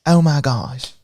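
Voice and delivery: slowly savored, exaggerated exclamation; a guessed-at woman's voice, drawn out with pauses; pretend Cynthia. Host voicing a viewer's comment, pretend voice. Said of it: "I like to pretend".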